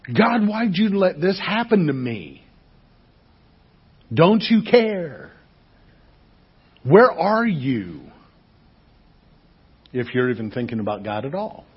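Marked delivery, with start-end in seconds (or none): 0.1-8.0 higher, slow anguished plea; slow pleading prayer; pleading complaint to God. Retold or imitated. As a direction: cry out to God as if abandoned. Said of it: "people today have the reaction of".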